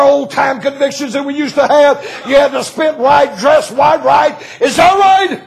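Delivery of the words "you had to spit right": ordinary delivery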